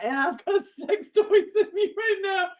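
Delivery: high laughter through the words; laughing. Real, not acted. Admitting to the audience, laughing. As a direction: laugh while confessing. Laughing at her own situation.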